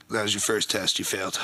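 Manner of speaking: low, quiet, flat verdict; low deadpan verdict. Retold or imitated. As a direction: deliver it dead serious and low. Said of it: "He goes".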